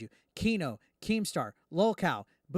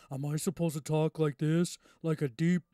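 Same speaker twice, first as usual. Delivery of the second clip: mock deep voice; 2 semitones lower than usual. Shown as a demonstration. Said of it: "I don't have a deep".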